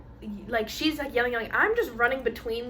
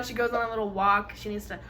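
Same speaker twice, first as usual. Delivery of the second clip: normal talking voice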